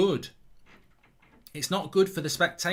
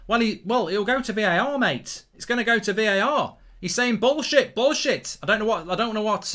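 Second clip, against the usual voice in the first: raised, loud and agitated reaction; excited commentary; 8 semitones higher, 6 dB louder than usual. Real, not acted. Host telling mate it'll go to VAR, excited and loud. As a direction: keep the pitch up, calling the disputed decision. Still reacting to the disputed penalty.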